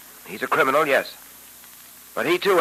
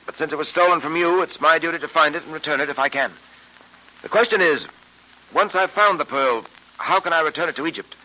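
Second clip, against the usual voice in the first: put-on old radio show. Old-time radio drama episode.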